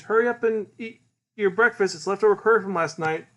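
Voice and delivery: high, brisk mom voice; mother hurrying son. Retold or imitated. Mother calls her son to breakfast, hurrying him.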